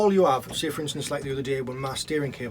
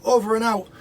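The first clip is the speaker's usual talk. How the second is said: mock film radio sign-off, higher and louder; mocking movie cliché; 8 semitones higher, 6 dB louder than usual. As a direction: scoff at it as the films say it. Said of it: "this really grates me when people go".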